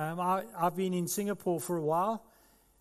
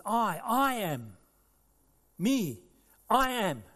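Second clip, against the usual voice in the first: slow, louder, higher I am; Jesus proclaiming; 4 semitones higher, 4 dB louder, slower than usual. Acted. Preacher voicing Jesus declaring his name, proclaiming. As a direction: ring out the divine name. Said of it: "He said".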